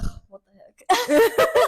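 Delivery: high, slow, laughing disgust; mock exasperated. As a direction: drag it out in disgust. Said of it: "But I was like".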